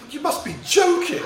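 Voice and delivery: high-pitched mock disbelief; incredulous rejection. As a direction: imitate a girl scoffing at a silly proposal, voice leaping high. Girl's scornful rejection, retold.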